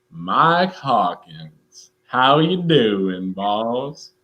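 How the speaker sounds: very slow imitated country drawl; buddy's drawled greeting. Buddy greeting him every time, slow drawl. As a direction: stretch every word in a mock country drawl. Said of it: "every time he sees me, he says".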